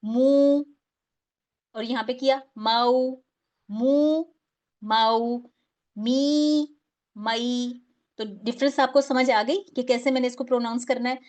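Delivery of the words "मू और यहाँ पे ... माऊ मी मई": मू and मी held long, माऊ and मई kept short and soft; long versus soft. Shown as a demonstration. Teacher contrasting madd asli with leen for students. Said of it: "वहां पे वाव मद्दा पे हमने क्या किया".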